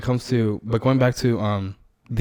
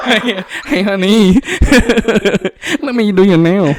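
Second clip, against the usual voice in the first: high, laughing mock broken English, much higher and louder; mock broken English accent; 10 semitones higher, 6 dB louder than usual. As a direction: tease a nail-salon accent while laughing. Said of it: "it's not an American accent".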